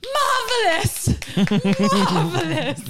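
high, slow posh-lady gush, breaking into a laugh; hammed-up society lady crying marvelous; rich lady gushing. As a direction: imitate a rich patron cheering from her seat. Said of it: "she's clapping and she's like".

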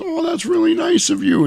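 very high, flattered character voice; Toll Booth Willie. Retold or imitated. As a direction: gush in a high put-on voice. Willie touched by the compliment.

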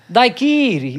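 "Daiquiri" with a long, punched middle syllable; stretched QUI in "Daiquiri", falling away on the end; stress on QUI. Shown as a demonstration. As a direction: show the stress in "Daiquiri": dai-QUIII-ri. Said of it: "onde é que está a sílaba".

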